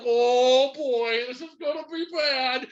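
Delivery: a light put-on voice of the coach dreading the mess; mock worried LaFleur. Host mocks his head coach facing a collapsing season, light put-on dread. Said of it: "LaFleur's just like".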